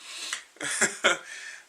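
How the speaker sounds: a short airy laugh; breathy laugh. Real, not acted. Reviewer laughing at a funny comic panel, breathy chuckle. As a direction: let out a quick breathless chuckle.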